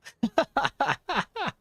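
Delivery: high, giggling laugh; amused laughter. Real, not acted. Streamer laughing at a silly character name, real giggles. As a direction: burst into a rapid string of giggles.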